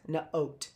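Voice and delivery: "Note" as nuh-oat, still split; two-piece "Note", nuh then oat. Shown as a demonstration. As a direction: say "Note" unblended, break after the N. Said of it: "Even if we say it fast".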